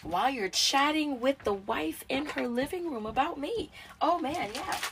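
high, loud, hammed-up gossiping mom; high, loud mocking mother voice. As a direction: mimic a nosy mother gossiping.

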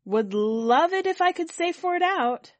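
high, loud, upset outburst; thick-voiced. A narrator's reading. Kit trying to stop Charlie going, thick-voiced. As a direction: plead loudly through emotion. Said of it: "My voice is thick".

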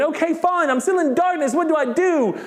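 high-pitched, impatient pleading; acted-out frustrated believer; mock exasperation. Acting exasperated reply to God.

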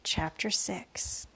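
ordinary delivery

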